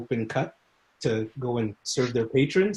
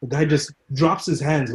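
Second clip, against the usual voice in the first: ordinary talk, nothing marked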